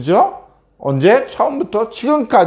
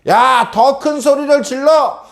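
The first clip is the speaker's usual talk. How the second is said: mocking taunt; 10 semitones higher than usual. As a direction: taunt them from a high pitch, stretching the words. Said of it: "하고 비웃으면서 이야기했던 거예요". (높은 목소리로 비웃듯이)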